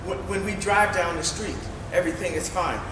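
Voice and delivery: usual speaking voice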